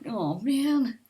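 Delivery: ordinary talk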